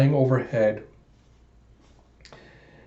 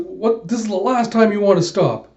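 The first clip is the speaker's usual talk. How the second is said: disbelieving chief; 7 semitones higher than usual. Acted. Chief objects to Lee's order, shocked protest. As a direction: push back in shocked disbelief.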